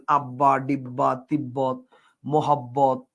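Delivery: low word list, double b held in each; distinct doubled ব from আব্বা to মোহাব্বত; clearly sounded b. Shown as a demonstration. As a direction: lean on the bb of আব্বা, ডিব্বা, তিব্বত, মোহাব্বত. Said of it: "তাহলে খেয়াল করেন".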